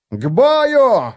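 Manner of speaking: high, loud battle cry; rally the troops with a yell; war cry. Acted. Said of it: "заорал он".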